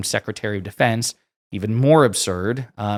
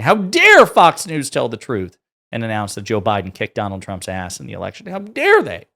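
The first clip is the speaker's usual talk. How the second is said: loud mocking outrage, peaking on the last how dare they; mock all-caps rant; 6 dB louder than usual. Reading her angry text as a hammed-up rant. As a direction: ham up her furious rant. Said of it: "went on this all-caps rant".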